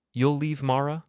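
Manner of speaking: incredulous. Acted. Father incredulous repeat.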